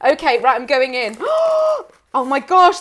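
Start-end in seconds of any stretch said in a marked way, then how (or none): 1.2-1.8 sharp, high intake of breath; real gasp of surprise; amazed gasp. Real, not acted. Opening the parcel, amazed.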